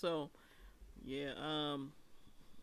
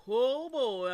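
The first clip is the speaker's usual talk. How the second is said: louder and higher burst; excited; 6 semitones higher, 12 dB louder than usual. Real, not acted. Host before a long-awaited topic, louder excited burst. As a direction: launch into the topic you've waited for.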